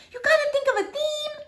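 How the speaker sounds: put-on high little girl voice. Sweetie Pie asking about the party, high and little. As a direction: chirp it like a small child.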